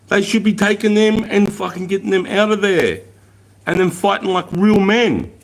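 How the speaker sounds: rant, rising in fury; getting angry. Real, not acted. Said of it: "I don't wanna get angry".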